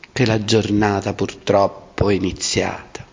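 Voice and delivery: ordinary delivery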